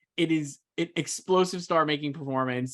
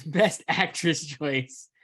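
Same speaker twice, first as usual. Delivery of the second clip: normal talking voice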